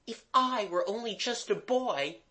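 put-on high-pitched girl's comeback; retorting girl. A narrator's reading. Mabel answering Jimmy's insult, retorting. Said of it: "Mabel retorted".

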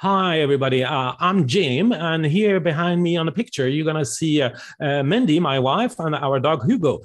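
playful imitation of a cheery American opener; a hint of a hammed-up US speaker introducing himself; American-style hello. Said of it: "la personne qui arrive et qui fait".

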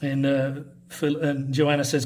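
ordinary talk

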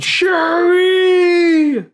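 high, loud excited shout of Shuri; yelled name. Retold or imitated. Fan recalling the portal scene, shouting Shuri. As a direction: yell it like the crowd in the theater. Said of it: "first I yelled".

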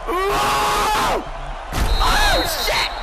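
sudden high yelp of surprise; shocked shout. Real, not acted. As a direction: caught off guard by the play. Shocked reaction to a play.

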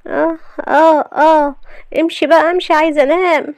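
high, grumpy wife shooing him off; sleepy annoyed wife. Acted. Wife woken by her husband, shooing him away in a high voice. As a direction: brush him away, cranky.

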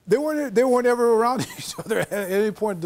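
high-pitched, breaking into laughter; amused disbelief. Real, not acted. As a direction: laugh through the words in amazement. Amused reveal about the commercial.

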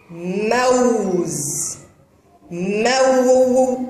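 "موز" slowly as mawwwz, gliding into a held w; voiced waw. Shown as a demonstration. Teacher tests whether it is madd, glide drawn out. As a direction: stretch the aw of موز. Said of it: "هنا الواو تنطق بصوتها".

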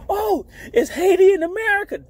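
put-on high, bright-eyed girl voice; imitating the woman. Woman asking about Haiti, high and naive. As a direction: ask it like a clueless girl. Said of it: "She like".

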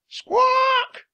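very high, loud bird screech; parrot squawk. A narrator's reading. Parrot arrives over the pirates, squawking shrilly.

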